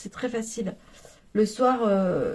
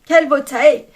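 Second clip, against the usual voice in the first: delighted exclamation; 3 semitones higher, 10 dB louder than usual. Real, not acted. Admiring it again, louder.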